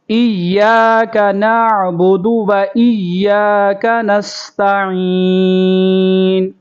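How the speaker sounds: slow melodic tajweed chant; measured teacher's tilawat; Quran recitation. Shown as a demonstration. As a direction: recite it slowly, melody drawn out for students to copy. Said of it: "میرے ساتھ آپ بھی دہرائیں۔".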